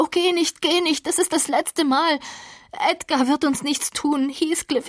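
cling to him with your voice; high desperate plea; desperate pleading. A narrator's reading. Catherine begging him to stay.

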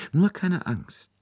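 high, slow, gentle coaxing; coaxing reassurance. A narrator's reading. Detective coaxes weeping nurse, high and slow.

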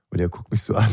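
ordinary talk, nothing marked

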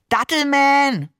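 high, drawn-out playful cry; gleeful, stretched-out exclamation; excited call. A narrator's reading. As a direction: call it out like a child's superhero name. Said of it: "rief der Seestern".

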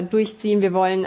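ordinary delivery

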